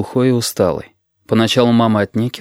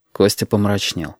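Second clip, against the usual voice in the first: ordinary delivery, nothing marked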